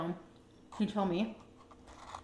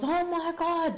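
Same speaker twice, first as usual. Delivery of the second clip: high-pitched mock yell of shock; retold yell; 9 semitones higher, 3 dB louder, slower than usual. Boyfriend in sudden panic after the deed, mock yell. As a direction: cry it out, much higher but only slightly louder. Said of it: "like, really yelled".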